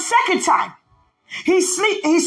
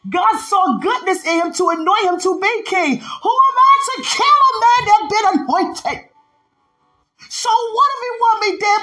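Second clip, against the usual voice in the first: crying out; 6 semitones higher than usual. Retold or imitated. David refusing to kill the anointed king, crying out. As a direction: pour out the anguish toward heaven. Preacher voicing David's anguish.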